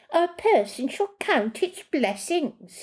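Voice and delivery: mock prim imitation, high and slow; mimicking her voice. Joshua mocking Marcella's prim voice, high and slow. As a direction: parrot her words back, high and slow. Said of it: "He was mimicking her voice".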